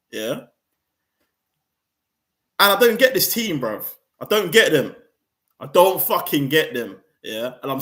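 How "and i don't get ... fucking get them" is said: angry outburst. Real, not acted. Fed-up fan venting at his own club, loud anger. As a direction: vent it hard, fed up.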